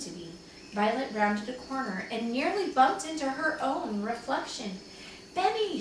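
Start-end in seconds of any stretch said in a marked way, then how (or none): 5.3-5.8 high, anxious call of a name; worried storybook cry. A narrator's reading. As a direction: call out worriedly, pitched well up. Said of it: "she cried out anxiously".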